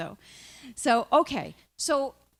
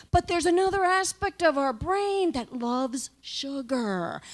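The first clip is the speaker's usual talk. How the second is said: ordinary talk, nothing marked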